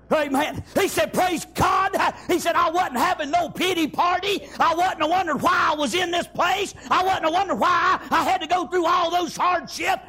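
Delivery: fervent shouted sermon delivery; preaching at full force. Acted. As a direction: preach it hard, phrase after phrase.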